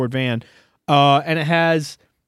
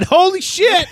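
high-pitched mock astonished cry; retold amazed outburst; 3 dB louder, slower than usual. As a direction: exclaim it in exaggerated shock. Retold reaction on spotting the plate.

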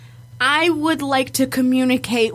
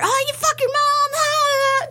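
high-pitched mock kid yelling; put-on high little-boy voice; pretend little boy; 13 semitones higher than usual. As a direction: squeal it like a bratty boy gamer. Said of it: "I would pretend to be little boy".

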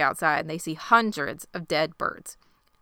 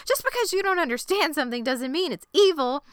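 mock yell; 9 semitones higher than usual. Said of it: "Lottie's yelling".

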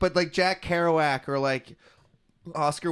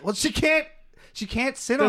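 much higher, bubbly quoted voice; excited high-pitched imitation; 10 semitones higher, 3 dB louder than usual. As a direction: gush it like an overexcited friend. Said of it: "She's like".

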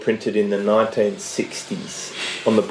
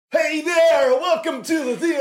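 much higher, hyped-up fake host voice; cheesy podcast host. Host launching his show intro in cheesy parody. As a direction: blast it like a wound-up showman intro. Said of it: "flicked a switch into parody land".

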